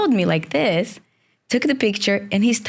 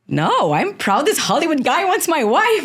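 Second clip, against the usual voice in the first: high, pleased mock husband voice; husband's proud reply; 10 semitones higher than usual. Husband telling a friend he's proud, lightly voiced. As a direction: play the husband, proudly unbothered. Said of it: "And he said".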